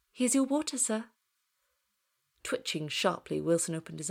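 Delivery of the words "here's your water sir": slightly higher, polite service voice; hint of an attentive cabin attendant; lightly voiced stewardess. A narrator's reading. Stewardess serves a passenger.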